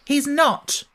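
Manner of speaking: put-on high, fierce girl's cry; indignant cry. Mary crying out up the wall, high and fierce. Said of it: "cried Mary".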